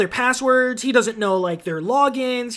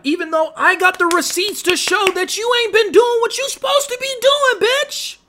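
rising, pitched-up yell getting louder to the end; angry shout; 13 semitones higher, 5 dB louder than usual. Acted. Venting at the bosses who stress him out, a high angry shout. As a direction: let it build into a high furious shout.

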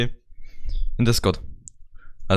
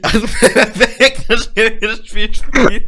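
slow, higher, laughing mock announcement; hammed-up segue; 8 semitones higher, 3 dB louder, slower than usual. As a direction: oversell the clumsy transition with a laugh. Deliberately clumsy segue to game. (übertrieben gespielte Überleitung, lachend)

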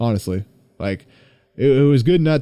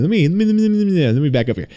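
high stuttering restart; tripping over words, worked up; excited stammer; 7 semitones higher, faster than usual. Real, not acted. Excited stammer before story.

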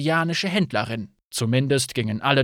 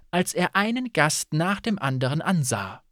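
ordinary delivery

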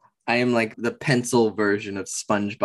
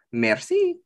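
much higher, lilting French merci; French accent. Retold or imitated. Repeating the customer's French word, high French accent. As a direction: say merci like the old French lady. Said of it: "Same exact French accent".

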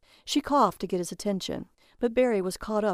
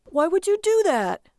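high, loud, bewildered question; puzzled protest; 11 semitones higher, 6 dB louder than usual. A narrator's reading. Barry questioning his wife, puzzled and protesting. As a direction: protest it in baffled dismay. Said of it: "Barry was puzzled".

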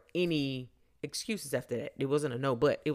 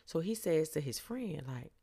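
ordinary talk

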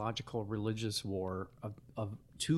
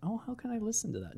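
mock high voice; 11 semitones higher, 5 dB quieter, faster than usual. Mock quoting people's question.

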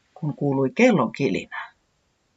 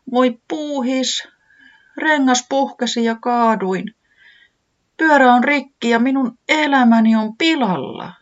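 high, slow, wailing bunny voice; a small bunny, heartbroken over its bike; tearful bunny; 10 semitones higher, 5 dB louder than usual. A narrator's reading. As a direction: wail it out in tears, pitched high. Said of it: "pillahti uudelleen lohduttomaan itkuun".

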